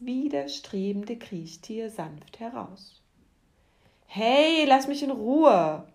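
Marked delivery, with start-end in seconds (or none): 4.1-5.9 high, loud yell to be let go; roaring. A narrator's reading. Worm grabbed from the dung heap, yelling loudly. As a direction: bellow at him to back off. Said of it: "brüllte der Wurm".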